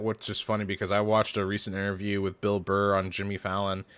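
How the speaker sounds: ordinary talk, nothing marked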